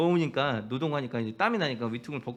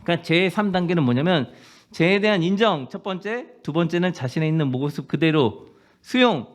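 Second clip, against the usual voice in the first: fervent preaching; 3 semitones higher, 5 dB louder than usual. Real, not acted. Pastor urging the congregation, fervent preaching. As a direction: drive the message home from the pulpit.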